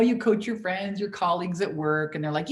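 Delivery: ordinary delivery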